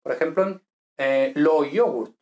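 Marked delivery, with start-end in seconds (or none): 1.3-2.2 lo yogurt said slowly, y as a glide; Italian demo word. Shown as a demonstration. Teacher showing the Y rule to students, slow Italian. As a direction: give lo yogurt slowly, clearly. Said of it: "E incluso la Y".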